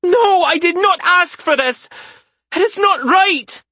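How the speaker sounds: high, loud horrified protest; outraged protest. A narrator's reading. Isaria protests the marriage to Rezkin, high and loud. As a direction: refuse it with all your voice.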